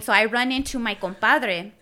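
ordinary talk, nothing marked

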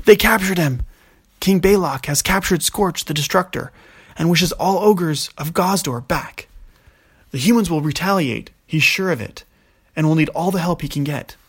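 urgent ogre messenger. Acted. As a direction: blurt the bad news breathlessly. Ogres burst in with news.

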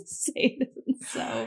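slow, dramatic whisper. Real, not acted. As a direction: whisper it in mock horror. Mock dread at Leprechaun.

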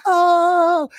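high, drawn-out impressed "oh"; crowd's "ohh". Retold or imitated. Onlookers reacting to rap lines, high impressed "ohh". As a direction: imitate a crowd hyped by a bar.

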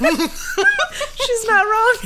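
slow, high words through laughter; laughing. Real, not acted. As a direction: wheeze it out while laughing. Laughing at the joke.

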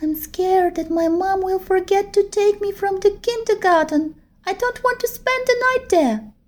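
put-on high, tearful little-girl no; a scared child refusing firmly, close to crying; firm refusal. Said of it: "firmly said".

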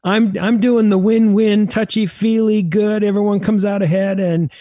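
hammed-up touchy-feely tone; mock win-win softie. Mock gentle negotiator self-description.

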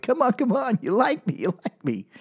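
high, needy mock begging; pathetic pleading. Comic as desperate closing-time guy, high and pathetically needy. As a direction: beg for affection in a high little voice.

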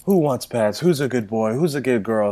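lightly sing-song pet voice; baby talk to dog. Retold or imitated. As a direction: coo like talking to a puppy. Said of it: "We're like".

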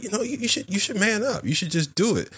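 a hint of a nudging conscience, high and hushed; goading inner voice. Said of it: "part of me is like".

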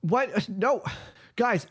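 high, panicked denial; Jeremy's voice. Acted. Jeremy denying he pushed her, high and panicked. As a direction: protest your innocence, rattled. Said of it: "You hear Jeremy's voice".

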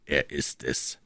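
low, soft mutter; murmured to himself. A narrator's reading. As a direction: mutter it under your breath as it dawns. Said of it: "murmelte Trevet". (leise vor sich hin gemurmelt)

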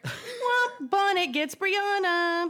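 high, drawn-out mock announcement; sing-song high punchline, slow; comic womp-womp. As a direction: stretch it out like a cartoon letdown. Comic sad-trombone plot summary.

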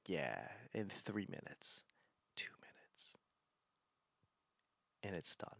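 very soft, low, near-whispered countdown; hushed calm prediction. Retold or imitated. Wife predicting when the storm ends, hushed and calm. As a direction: murmur it like a quiet forecast.

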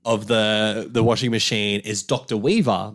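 normal talking voice

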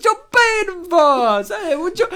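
put-on high, loud, slow Italian New Yorker accent. Host turning the name into Italian New Yorker gibberish. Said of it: "Sounds like Italian gibberish".

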